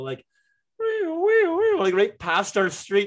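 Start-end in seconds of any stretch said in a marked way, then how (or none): 0.8-1.8 very high, loud mock siren; imitated siren wail. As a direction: do the fire truck siren zooming by. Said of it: "this fire truck go".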